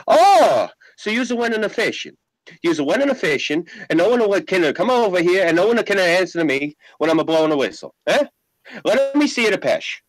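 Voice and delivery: high, angry mock Italian accent; hammed-up furious Italian father; angry old immigrant. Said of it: "He goes like this".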